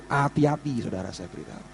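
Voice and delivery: solemn low caution; hushed warning. Real, not acted. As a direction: drop the voice to caution them.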